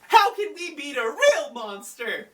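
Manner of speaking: high-pitched, mock-worried question; Yugi. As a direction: ask it in a hammed-up high voice. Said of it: "and Yugi's like".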